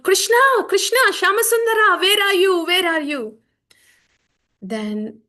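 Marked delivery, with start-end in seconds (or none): 0.0-3.4 much higher and louder, crying for Krishna; calling out. Acted. Chaitanya calls out for Krishna, loud longing cry. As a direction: call out desperately across distance. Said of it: "calling out".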